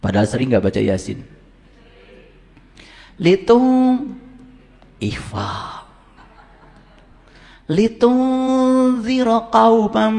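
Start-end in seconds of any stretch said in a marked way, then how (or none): 3.2-10.2 slow, drawn-out garbled Quran reading, "Litung ziro kaumam"; "Litung" sung high and slow, like a bad reciter; mock wrong recitation. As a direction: parody a stiff tajwid reciter. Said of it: "Salah bacanya, masuk neraka".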